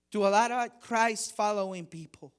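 usual speaking voice

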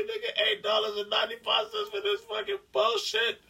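incredulous. Real, not acted. Outraged about popcorn price.

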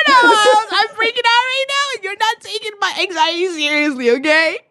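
mock panic. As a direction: play the freak-out with a catch in the voice. Retold panic before going on.

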